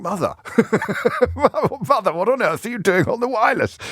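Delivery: high-pitched, affronted mock voice; caller's son impression. Host pretending to be the son on the extension, mock-indignant. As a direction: play the scandalised son, pitched right up. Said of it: "having him on the extension going".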